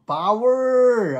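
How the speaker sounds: big high shout of Power; rousing church shout. Retold or imitated. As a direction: belt it out like a church crowd. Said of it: "they just love to shout it out".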